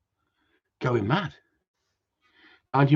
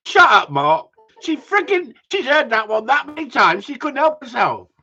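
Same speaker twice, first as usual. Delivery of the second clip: high, raised-voice rant; exasperated shouting; 11 semitones higher, 6 dB louder than usual. Real, not acted. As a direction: snap back loudly, pitch right up. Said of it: "I don't know why I'm shouting".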